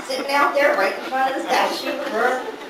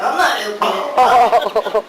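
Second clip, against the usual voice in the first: louder, laughing mock protest; retold refusal; 8 dB louder than usual. Relative refusing to open the urn, mock protest. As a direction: refuse loudly through a laugh.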